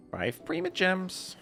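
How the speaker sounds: high, slow, drawn-out glee; gleeful announcement. Real, not acted. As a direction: stretch it out and climb high in delight. Streamer celebrates the reward.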